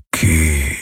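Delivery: low, murmured name; Hawks murmuring. A narrator's reading. Wounded Hawks murmurs Kirai's name, low and faint. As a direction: breathe her name out weakly. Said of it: "hörte sie ihn raunen".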